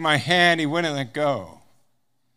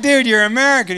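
higher and louder, thrilled to find a fellow American; excited discovery; 6 semitones higher, 6 dB louder, faster than usual. Retold or imitated. Prisoner greeting an American on the bus, excitedly high and loud. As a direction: burst out with delighted surprise.

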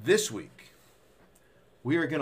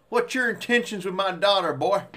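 gruff overprotective dad; 5 semitones higher, 6 dB louder than usual. Acted. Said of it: "I could do that all day".

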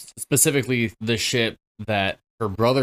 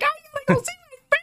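very high, racing squeal; hammed-up frantic runaway; mock panic; much faster than usual. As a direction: blurt it fast in a squeaky panic. Imitating panicked crowd fleeing.

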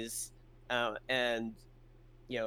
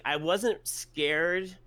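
emphatic denial; 5 semitones higher, 3 dB louder, much slower than usual. Real, not acted. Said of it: "stretched emphatic words".

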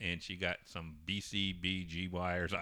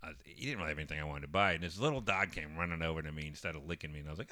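ordinary delivery

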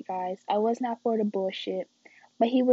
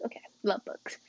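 soft whispered pet name; hushed and affectionate; 2 semitones lower, 7 dB quieter, faster than usual. Real, not acted. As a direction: whisper love bugs fondly. Nicknaming viewers.

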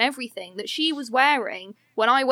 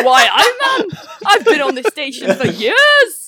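louder, mock Geordie, laughing through it; Geordie accent; 4 semitones higher, 8 dB louder, slower than usual. Geordie Odo claims his station, laughing Geordie. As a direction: puff up in a playful Geordie. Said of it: "Odo's a Geordie".

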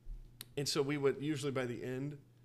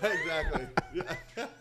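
laughing; 6 dB louder, slower than usual. Real, not acted. Laughing agreement.

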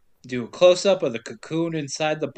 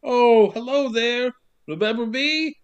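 teacher calling out; 6 semitones higher than usual. Retold or imitated. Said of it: "starts calling out like".

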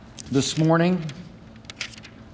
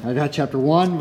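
usual speaking voice

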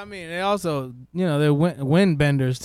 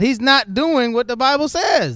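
emphatic preaching; 8 semitones higher, 7 dB louder, slower than usual. Real, not acted. Preaching about a false pastor.

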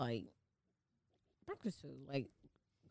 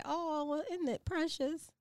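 mock sweet; 11 semitones higher than usual. What you say to ugly babies.

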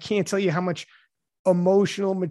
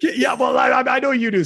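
louder and higher, talking over her; a good-natured shout; laughing shout; 7 semitones higher, 7 dB louder than usual. Real, not acted. Retorting over co-host's interruption.

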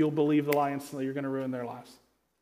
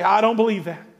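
mock stubborn refusal; 5 semitones higher, 3 dB louder than usual. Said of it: "we do one of those to Satan".

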